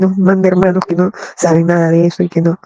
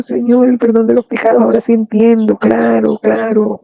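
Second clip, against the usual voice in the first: higher, rising praise to the Lord; fervent prayer; 4 semitones higher, slower than usual. Retold or imitated. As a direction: pour out grateful praise, voice lifting. (oración ferviente de alabanza, voz más aguda)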